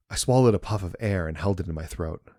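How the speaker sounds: plain reading voice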